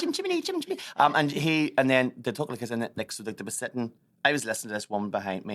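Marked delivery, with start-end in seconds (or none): 0.0-0.8 very high chirpy chant; mock Cockney chirp. Mocking Mary Poppins, very high chirpy Cockney chant. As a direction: chirp it like a music-hall Cockney.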